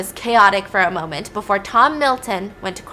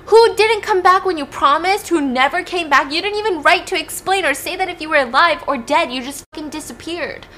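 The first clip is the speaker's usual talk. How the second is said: loud high outburst, easing at the end; hurt, furious accusation; 9 semitones higher, 5 dB louder than usual. Acted. Kaya accusing Tate of abandoning her, acted fury. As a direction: explode with hurt, then run on in reproach.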